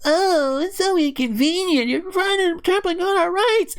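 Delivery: very high, put-on complainer's voice; mock the protesters' griping in a high voice; mocking whine.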